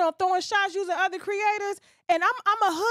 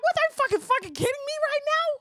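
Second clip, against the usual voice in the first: disbelief; 10 semitones higher than usual. Retold or imitated. Retelling her outraged reaction.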